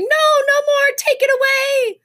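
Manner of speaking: very high, panicked shriek; high, loud frightened protest; child's scream. A narrator's reading. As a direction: scream like a terrified little boy. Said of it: "Sam started to scream".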